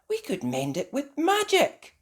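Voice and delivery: put-on high-pitched child character; fairy's suggestion. A narrator's reading. Strawberry offering magic to her friends, high voice.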